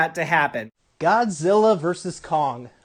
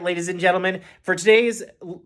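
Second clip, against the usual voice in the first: ordinary delivery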